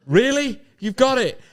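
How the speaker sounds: high, incredulous squeal of disbelief; surprised. Acted. Gertrude staring at John, squealing in shock. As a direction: shoot the pitch way up in disbelief. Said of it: "she looks and goes".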